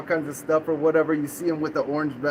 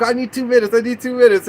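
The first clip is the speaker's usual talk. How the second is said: imitated urgent stalling, pitched up; raised, insistent retold plea; pleading girlfriend; 5 semitones higher, 5 dB louder than usual. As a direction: act out her begging for time. Voicing girlfriend stalling police.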